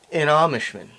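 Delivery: ordinary talk, nothing marked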